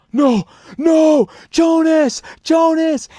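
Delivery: high, loud panicked cry for a friend; panicked scream. Retold or imitated. Pranking friend crying out for Jonas, high panicked scream. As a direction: scream it in terror.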